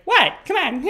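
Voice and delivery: high playful "what, come on"; mock flirty date. Date coaxing him along, high playful. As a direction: tease him to hurry.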